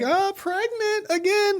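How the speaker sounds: very high, slow drawn-out wife voice; mock wife. Retelling the wife revealing her pregnancy, very high and slow. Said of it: "she's like".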